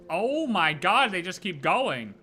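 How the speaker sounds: normal talking voice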